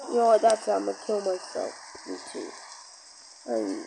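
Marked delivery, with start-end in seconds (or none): 2.1-3.9 deep voice. Acted. Deep character reply.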